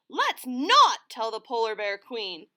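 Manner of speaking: snuffled. Acted. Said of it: "snuffled Harry Clary".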